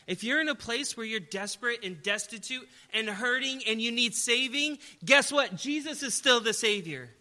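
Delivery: loud, high rousing sermon; full-force preaching. Acted. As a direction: rouse the hurting listeners with hope.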